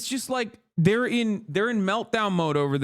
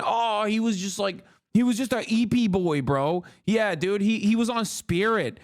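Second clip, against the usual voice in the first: usual speaking voice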